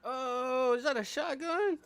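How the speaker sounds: high, loud startled cry; shocked shout. Real, not acted. Streamer spots a shotgun in the game, shocked high shout. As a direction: yelp it out in shock.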